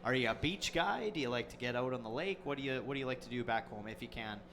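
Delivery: ordinary talk, nothing marked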